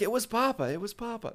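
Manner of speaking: high-pitched "It was Papa", no break before "Papa"; comma left out. Retold or imitated. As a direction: run straight into "Papa" in a high voice. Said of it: "he didn't read the comma".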